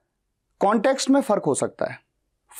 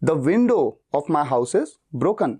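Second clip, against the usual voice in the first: slow take, window punched, lower; stress on WINDOW; 4 semitones lower, much slower than usual. Shown as a demonstration. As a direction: press window again, slowly. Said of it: "मैं खिड़की पे जोर दे रहा हूं".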